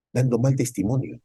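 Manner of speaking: ordinary delivery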